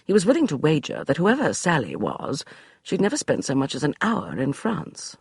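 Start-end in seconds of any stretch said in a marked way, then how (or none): none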